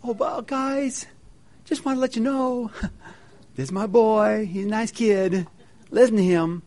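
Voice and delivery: mock offhand God, lightly voiced; casual mock God. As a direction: toss it off like a casual buddy. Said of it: "And it's not".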